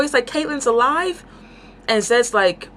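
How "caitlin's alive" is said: high, rising hopeful question; lightly acted happy astonishment; retold joyful disbelief. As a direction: imitate someone overjoyed, no real tears. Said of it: "he's like crying tears of joy".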